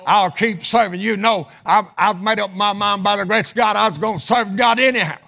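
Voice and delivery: full-throated pulpit exhortation; impassioned preaching. Real, not acted. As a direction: preach it with rising fervor.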